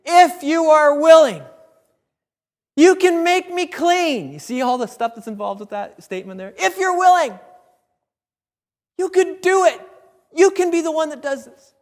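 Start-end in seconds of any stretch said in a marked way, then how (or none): 0.0-4.3 acted desperate plea, loud and high; drawn-out, full-voiced begging. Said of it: "he's begging". 6.6-11.8 acted plea, high and loud; begging. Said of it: "he's begging".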